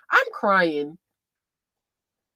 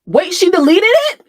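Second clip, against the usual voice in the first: loud, high-pitched cry of disbelief; shocked discovery; 13 semitones higher, 14 dB louder than usual. Real, not acted. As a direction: shriek it in real shock. Finds the post deleted.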